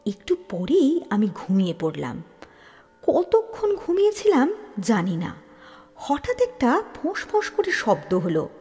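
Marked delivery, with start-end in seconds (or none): none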